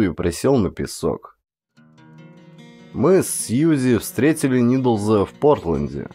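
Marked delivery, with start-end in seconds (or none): none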